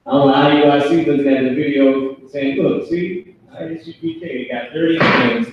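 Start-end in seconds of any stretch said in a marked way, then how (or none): 2.6-5.5 mocking haters. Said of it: "they some haters".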